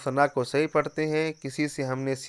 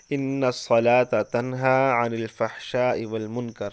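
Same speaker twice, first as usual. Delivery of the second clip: Quranic recitation; slower than usual. Acted. Quoting a Quran verse.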